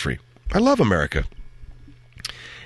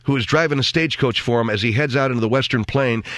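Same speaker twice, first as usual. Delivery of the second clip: ordinary delivery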